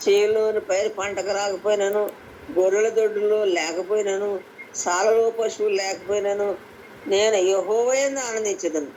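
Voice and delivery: ordinary talk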